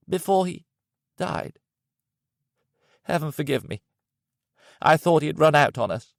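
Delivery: high, pained, self-reproach; grieving Count. Acted. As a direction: plead with heaven in grief.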